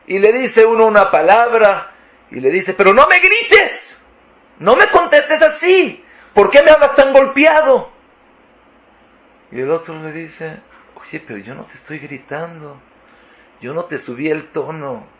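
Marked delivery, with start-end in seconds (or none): 2.7-7.9 high, loud indignant outburst; angry protest. Acted. Hurt partner protests at being shouted at, angry and loud. Acted quarrel between a couple. 10.9-15.1 soft, hushed self-defence; quiet denial. Acted. Accused partner insists there was no shouting, quiet denial.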